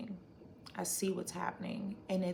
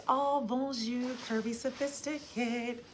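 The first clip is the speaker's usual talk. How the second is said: playful French greeting; 5 semitones higher, 3 dB louder than usual. Pretend. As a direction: greet them with theatrical French flair. Greeting viewer in French flair.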